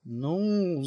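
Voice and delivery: soft, drawn-out hedge; faltering. A narrator's reading. Fili faltering before Nicole's invitation. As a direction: hesitate softly on the word. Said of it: "замялся Фили".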